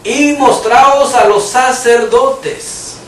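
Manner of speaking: drawn-out, weighty command; the Lord's solemn command, slow and weighty. Retold or imitated. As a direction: say it slowly like a solemn order. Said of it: "mire que le dijo el Señor".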